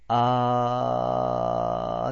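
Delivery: a long voiced "あー"; 有声音のあー. Shown as a demonstration. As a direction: hold "あー" so the throat buzzes. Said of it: "あーって言ってごらん". (喉を震わせて「あー」)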